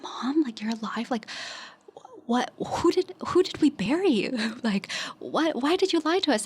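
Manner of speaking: reliving a dream's disbelief, pitch jumping high; mildly acted shocked questions to mom; retold consternation.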